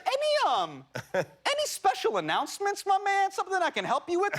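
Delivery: high, overfriendly operator voice; balloon guy voice. Retold or imitated.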